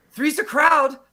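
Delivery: high, loud mock quote; movie line. Fan quoting Tommy's own movie line at him, high and mocking. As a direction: pipe it up teasingly. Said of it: "one of his own lines from his movie".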